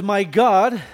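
emphatic. Acted. Preacher emphasising quoted banker image.

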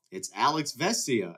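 ordinary delivery

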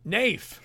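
"Nafe" with a long ay, rhyming with safe. Shown as a demonstration. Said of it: "Or is it an A?".

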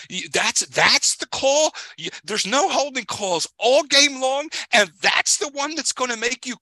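can't believe the call, high and heated; sports fan ranting about the referee's call, high and indignant; incredulous outrage. Real, not acted.

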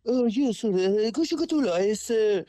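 high, hammed-up fake Russian accent; mock a Russian caller badly. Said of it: "what do you want me to be like".

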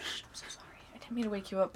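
very soft, hushed apology; playful, barely voiced sorry; mock apologetic whisper. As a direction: whisper it as if beside a sleeper. Whispered apology in pretend scene.

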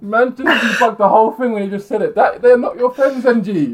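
high, loud, slowed mock outrage; excited teasing. Real, not acted. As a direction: crow over the point. Teasing friend after his answer.

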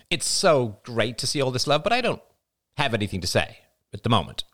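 put-on high, evasive young woman; sullen young woman. A narrator's reading. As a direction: brush it off quickly. Jada declines to speak.

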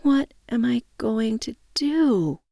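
high, slow, helpless question; despairing. A narrator's reading. Woman lying on the couch asks herself, despairing. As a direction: draw it out helplessly. Narrator acts her despair.

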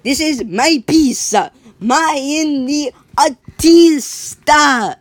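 high, slow, loud put-on artist voice; a hammed-up artist claiming his work; mock artist claim. Artist argues with police.

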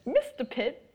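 hammed-up high posh British chirp; mock British. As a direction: play a silly upper-class Brit. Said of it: "we're an insult to the British people".

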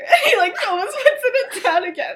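ordinary talk, nothing marked

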